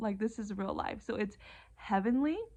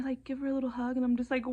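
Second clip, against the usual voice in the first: ordinary delivery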